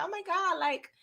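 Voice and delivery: high, fast, soft whiny voice; lightly voiced whiny earnest kid; mocking Brayden. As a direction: make fun of his earnest naivety. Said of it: "He was like".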